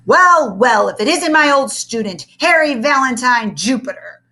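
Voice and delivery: loud menacing teacher voice; growling. A narrator's reading. The strict teacher growling at his old student, loud. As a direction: growl it like a cat catching a mouse. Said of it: "growled Mister Kinderschmacher".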